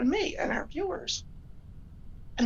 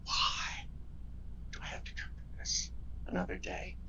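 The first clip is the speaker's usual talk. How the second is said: a much softer, breathless whisper of pain; weary whisper; 9 dB quieter than usual. Retold or imitated. Woman waking in pain asks why, whispering wearily. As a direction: sigh the question out in a tired whisper. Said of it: "and it's like".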